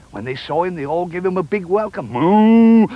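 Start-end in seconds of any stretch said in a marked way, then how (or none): 2.1-2.9 long, loud moo; cow's moo. Acted. Farm animals greeting Jack home, mooing. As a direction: bellow out a big cow moo.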